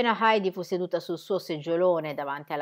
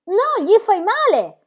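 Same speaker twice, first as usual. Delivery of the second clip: high, loud, slow cry of protest; a child crying out no, high and loud; shouting to stop him; 13 semitones higher, 10 dB louder, slower than usual. Acted. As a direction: yell it high to stop the blow. Heidi stops Peter hitting goat.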